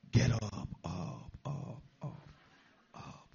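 deep, fading mock voice of God; mock divine voice. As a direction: play the booming movie voice of God, dying away. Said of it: "he says".

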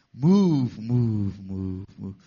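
mock divine command. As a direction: boom it like a movie God, then sink. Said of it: "he says".

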